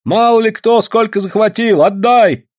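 high, loud demand; indignant shout. A narrator's reading. Prospector in a quarrel over claims, indignant shout. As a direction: argue loudly, pitched high.

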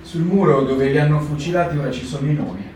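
ordinary delivery

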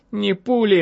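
woman patient's pointed objection; objecting. A narrator's reading. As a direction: cut in to object, voice lifted. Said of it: "возразила я".

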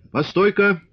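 calling out from afar. A narrator's reading. Old man calls to arriving riders from afar, calling out. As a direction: hail someone across a yard. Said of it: "крикнул он ещё издали".

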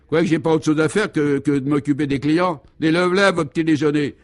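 high, indignant chambermaid; cross retort. A narrator's reading. Chambermaid refusing to fuss over a guest, cross. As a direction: throw the complaint back angrily. Maid snaps back at the guest.

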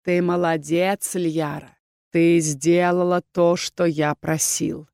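slow, drawn-out villain praise; gloating villain leader. Acted. Rebel leader praising his helper, gloating. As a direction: savour every word, smugly approving.

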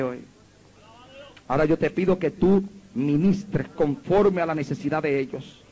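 low, earnest prayer to God. Acted. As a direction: pray it humbly over the people. Closing prayer.

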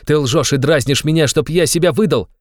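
loud, high, agitated cry; frenzied accusation. A narrator's reading. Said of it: "Ведь вы в выступлении пришли".

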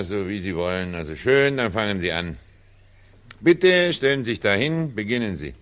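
ordinary talk

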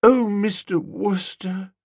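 high, slow, sighing address; swooning dreamy woman. A narrator's reading. Woman moved by talk of love, swooning. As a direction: breathe the name out, drawn out and high.

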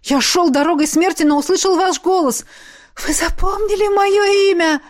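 high voice, rising in wonder; excited gushing. A narrator's reading. Revived man following his master, excitedly grateful. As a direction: gush with growing amazement.

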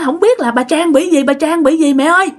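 put-on high, urgent child calling mom; retold panicky cry for mother; panicked report. (giọng cuống quýt gọi mẹ)

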